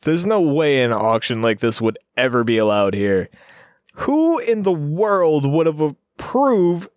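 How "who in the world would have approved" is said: high, drawn-out question; hammed-up disbelief, pitched up; acted incredulity. Captive asking who approved auction, acted incredulity.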